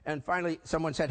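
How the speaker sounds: ordinary talk, nothing marked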